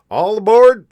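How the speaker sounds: loud, high call to board; conductor's cry. A narrator's reading. Conductor calling the boy aboard, loud high call. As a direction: belt it out like a train conductor. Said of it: "the conductor cried out".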